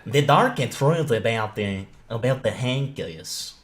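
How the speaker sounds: slow, hammy imitation of a Swedish accent; mock Swedish narrator, drawn out. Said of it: "That sounded Swedish".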